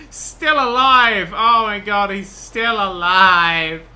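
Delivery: loud, slow drawn-out exclamation; amazed outcry. Real, not acted. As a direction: cry out in disbelief, stretching the words. Sees game victim still alive.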